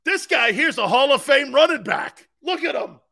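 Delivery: high, loud sports-fan raving; retold loud boasting. Grandfather suddenly praising an Italian player, loud raving. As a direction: shout his praises like a new convert. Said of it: "He's like".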